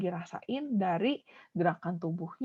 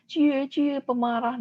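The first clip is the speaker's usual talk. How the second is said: higher sing-song mock; teasing; 6 semitones higher, slower than usual. Shown as a demonstration. Teacher acts a child taunting an angry friend, teasing. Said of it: "malah diejekin".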